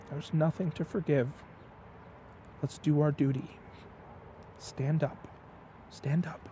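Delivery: a hushed gentle retelling of her forgiveness; soft tender reassurance. Retold or imitated. Woman cradling the kneeling man's face, softly and tenderly reassuring him.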